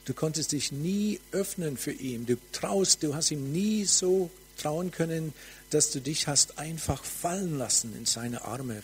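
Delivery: ordinary delivery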